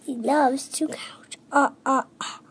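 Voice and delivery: soft, whispered echo of a puppet vampire; hushed copy with a tiny ah-ah-ah laugh; whispered imitation. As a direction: copy the counting vampire under your breath. Child echoes the Count impression softly.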